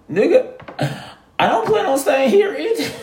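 high, laughing through the words. Real, not acted. Laughing as he admits to his friends that he plans to leave too. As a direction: let the laugh carry it, teasing.

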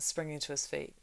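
ordinary delivery

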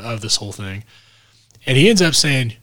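ordinary delivery

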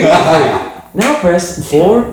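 very slow, drawn-out phone menu voice; slow automated phone voice. Retold or imitated. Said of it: "I convinced your mum".

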